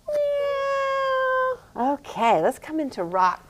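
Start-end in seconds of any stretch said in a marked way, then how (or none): 0.1-1.6 high, drawn-out cat meow. Shown as a demonstration. Teacher meowing like a cat for a child in cat-cow. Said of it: "One more".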